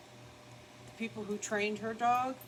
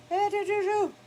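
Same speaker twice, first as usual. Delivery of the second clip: cooing at dog; 8 dB louder than usual. Real, not acted. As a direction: coo at the puppy.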